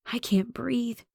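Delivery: soft breathless whisper; barely voiced, hushed; frightened whisper. A narrator's reading. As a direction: whisper it, hidden and scared. Hiding in fear from the man.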